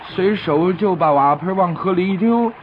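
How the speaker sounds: usual speaking voice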